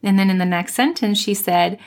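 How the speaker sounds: normal talking voice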